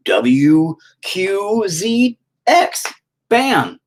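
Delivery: slow, high, loud letter by letter; drawn-out letters. Acted. Answering that they too can say random letters, drawn out. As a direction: stretch each letter out, then punch the end.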